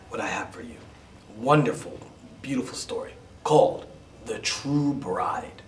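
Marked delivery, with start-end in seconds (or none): 4.3-5.6 a slow whispered title. Shown as a demonstration. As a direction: hush the title, slow and breathy. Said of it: "title whispered".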